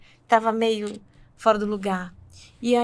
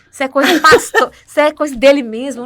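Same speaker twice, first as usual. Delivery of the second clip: desdém alto, entre risos; desdém rindo; 11 dB louder than usual. Retold or imitated. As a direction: desdenhando do conselho em voz bem mais alta. Ela desdenha do pastor, rindo. (desdém alto e risonho, dando as costas ao pastor)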